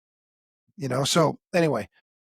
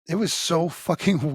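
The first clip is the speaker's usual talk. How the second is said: ordinary talk, nothing marked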